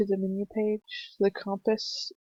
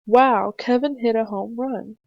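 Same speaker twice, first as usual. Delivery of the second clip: loud, thrilled shout of wow; amazed exclamation; 6 dB louder than usual. Shown as a demonstration. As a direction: cheer for the home run. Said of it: "it shows that they were amazed".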